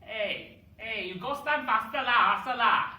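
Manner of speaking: loud high Manglish hurrying someone; Singlish. Retold or imitated. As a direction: nag it in thick Malaysian English. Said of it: "That's basically how we speak".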